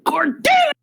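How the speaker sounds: high exasperated call; shouting. Acted. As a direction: shout the name in frustration. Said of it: "the second shout of".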